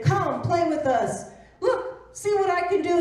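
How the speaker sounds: high, loud, teasing bunny voice; taunting bunny. Acted. Bunny taunting the rabbit, high and loud. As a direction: show off and goad him. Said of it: "kept taunting him and saying".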